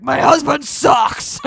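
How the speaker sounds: loud, slow hammed-up complaint; mock shout. Cohost playing a fed-up mom, mock shout. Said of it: "they're like".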